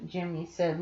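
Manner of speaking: ordinary delivery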